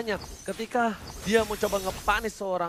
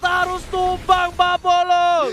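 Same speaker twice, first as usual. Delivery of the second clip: excited; 8 semitones higher, 5 dB louder than usual. Real, not acted. Caster calling the fight live.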